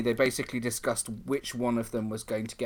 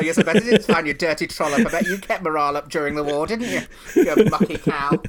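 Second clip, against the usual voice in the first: louder, saucy mock scolding; cheeky teasing; 4 semitones higher, 9 dB louder than usual. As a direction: tease her like a bawdy panto dame. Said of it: "I say".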